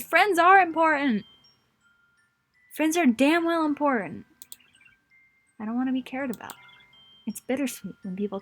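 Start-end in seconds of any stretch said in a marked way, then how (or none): 0.0-1.2 loud, slow, insistent cry; emotional outburst. Acted. Sayori shouting to her friend that friends matter, loud. 2.7-4.2 loud, pressing the point home; forceful insistence. Acted. Sayori pressing her friend that friends matter, forceful.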